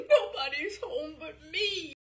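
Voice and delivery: loud, high tearful blubber. Acted. Lonely boy telling the operator, blubbering. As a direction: sob it out, all alone. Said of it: "I blubbered".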